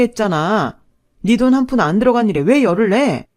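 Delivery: loud and high, hammering every question; furious scolding. Acted. Wife furiously berating husband over spending. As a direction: tear into him, no pause.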